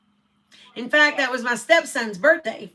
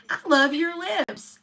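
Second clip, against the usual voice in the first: higher and slow, singsong tease; mock repeating; 6 semitones higher, slower than usual. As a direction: lift it up and stretch each word. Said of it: "he's continually repeating it".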